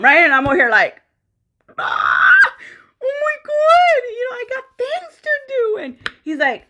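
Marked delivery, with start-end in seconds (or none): 1.8-5.8 high, hammed-up crying shout; mock wail. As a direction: wail it in comic despair. Retold wailing on waking late.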